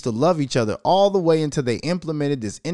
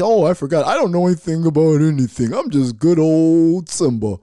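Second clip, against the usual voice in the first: innocent face; 5 dB louder than usual. Pretend. Putting on a face for family, mock-innocent loud voice. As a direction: play dumb like you know nothing. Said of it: "I gotta kinda put this face".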